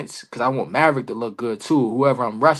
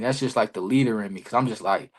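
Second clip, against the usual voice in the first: normal talking voice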